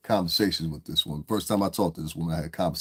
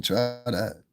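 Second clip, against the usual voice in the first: usual speaking voice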